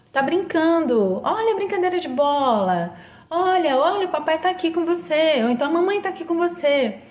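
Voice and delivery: put-on high, sing-song baby talk; soothing a child. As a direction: coo to a baby on the phone. Parent shows baby on phone call.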